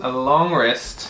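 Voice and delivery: slow long rest. Acted. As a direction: drag out "A long rest" slowly, each word stretched. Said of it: "Did you guys hear that?".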